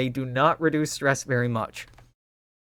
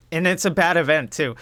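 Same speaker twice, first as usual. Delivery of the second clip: normal talking voice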